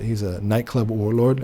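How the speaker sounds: ordinary talk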